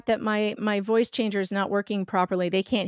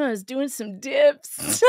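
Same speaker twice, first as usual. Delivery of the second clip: laughing; 5 semitones higher, 8 dB louder, slower than usual. Real, not acted.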